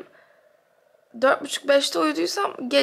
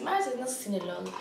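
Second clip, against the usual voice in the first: ordinary talk, nothing marked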